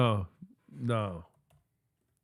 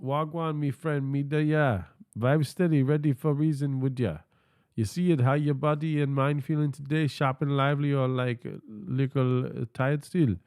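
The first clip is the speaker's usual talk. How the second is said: higher-pitched Jamaican patois lilt; 5 semitones higher than usual. Acted. AI assistant checking how the streamer feels, Jamaican patois. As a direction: put on an upbeat Jamaican patois accent. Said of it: "Uh, do patois".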